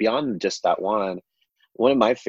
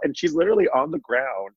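ordinary talk

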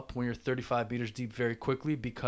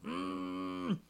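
even higher muffled squeal, lips shut; closed-mouth mock scream, pitched up high; underwater scream; 6 dB quieter than usual. Shown as a demonstration. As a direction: push the trapped scream higher still. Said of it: "that's what it sounds like".

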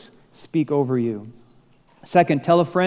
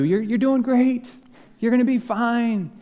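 higher, calling up encouragement; yelling up; 9 semitones higher than usual. Retold or imitated. Guide yelling up to the climber, higher. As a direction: shout encouragement from below. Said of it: "yelling up at you".

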